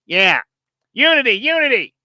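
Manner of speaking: high, loud nasal cheer; nasally teammate impression. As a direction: mimic a very nasally huddle chant. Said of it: "a nasally voice".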